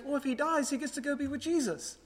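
lightly voiced three-year-old, sweetly explaining; a hint of a small boy, simply innocent; innocent child voice. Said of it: "just very innocently and goes".

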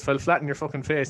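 usual speaking voice

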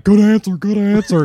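loud, high game-show cheer; cheering uncle. Acted. Uncle cheering on his team at Family Feud, loud hammed-up cheer. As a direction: whoop it out like an overeager contestant.

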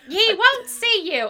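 high, loud, slow mock guard voice; hammed-up doorman refusal; gatekeeper impression. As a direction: bark it like a stern gatekeeper. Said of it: "he's like".

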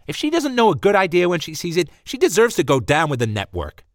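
loud, high indignant outburst; indignant rant. Acted. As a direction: rant in righteous outrage. Michelle ranting.

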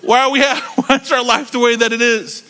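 high, hammed-up childish wail; theatrical cry of despair; mock wailing scream. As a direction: wail it like a betrayed kid. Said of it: "We screamed".

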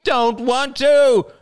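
slow, very high, loud refusal; a toddler shrieking his refusal; screamed. A narrator's reading. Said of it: "screamed Ben".

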